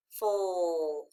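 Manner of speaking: "full" drawn out low, falling; long "full" sinking in pitch; isolated final syllable. Shown as a demonstration. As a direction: stretch "full" as one lone syllable. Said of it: "The second syllable says full".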